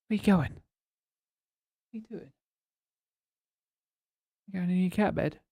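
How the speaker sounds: squeaky pet voice for the cat; silly clown. Real, not acted. Cooing at cat.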